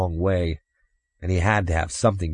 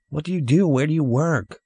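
put-on high, lilting child's questions; quizzing child; 9 semitones higher than usual. Boy asking stranger about his job, quizzing child voice. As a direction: press him like an inquisitive kid.

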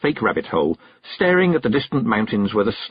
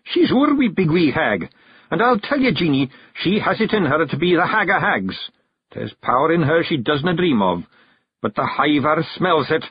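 higher, earnest Scottish character voice; Scots clan chief, earnest; 8 semitones higher than usual. A narrator's reading. Rob tells his wife of the hag's power, earnest. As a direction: speak up proudly in broad Scots.

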